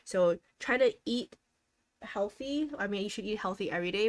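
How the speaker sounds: ordinary talk, nothing marked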